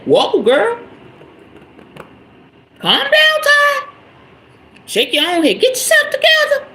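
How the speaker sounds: high-pitched mock alarm, reining yourself in; hammed-up high self-check; self-scolding. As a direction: talk yourself down in a squeaky, exaggerated high voice. Acts out checking herself.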